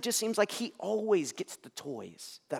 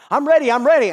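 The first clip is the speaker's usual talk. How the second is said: put-on higher, much louder child insisting; whiny kid pleading to be let out; impatient child; 11 semitones higher, 17 dB louder than usual. Son voiced, impatient.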